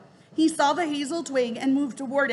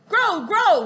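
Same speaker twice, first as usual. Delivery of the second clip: loud, higher bird call; a raven leader calling, louder and higher; raven croak; 5 semitones higher, 7 dB louder than usual. Acted. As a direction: croak to the flock. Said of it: "Rock croaked".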